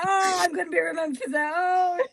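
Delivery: high, loud mortified groan; mortified wail. Retold or imitated. Volunteer realising her blunder, loud mortified wail. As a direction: wail that you'll be remembered for it. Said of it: "And I was like".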